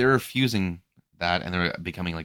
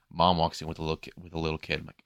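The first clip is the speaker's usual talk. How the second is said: ordinary talk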